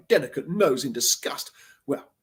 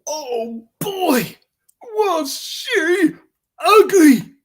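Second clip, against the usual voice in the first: disgusted shock; 13 semitones higher, 9 dB louder, much slower than usual. Acted. Wolf shocked at the crone.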